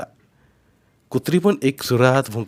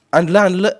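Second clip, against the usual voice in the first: raised, higher voice; calling back; 8 semitones higher, 6 dB louder than usual. A narrator's reading. Boy telling uncle he brought food, calling back. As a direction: answer loudly across a gap. Nephew answers across the stream.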